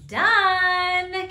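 commanding magical unicorn. Acted. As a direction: grant the wish with a ringing call. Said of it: "came the voice of the unicorn".

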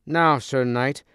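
sneering challenge; taunting. A narrator's reading. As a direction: jeer at the enemy knight. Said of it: "taunted Sir Accalon".

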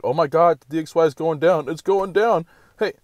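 high, loud mock panic; mock screaming. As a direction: imitate hysterical YouTubers screaming in alarm. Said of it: "screaming, like".

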